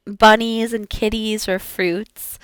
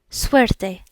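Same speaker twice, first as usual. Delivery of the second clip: ordinary delivery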